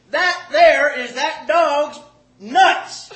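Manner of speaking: slow, loud, high Arkansas pronouncement; hammed-up Arkansas woman, drawing out every word; Arkansas accent. Toothless lady revealing the jar, slow Arkansas holler. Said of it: "like, she's from Arkansas".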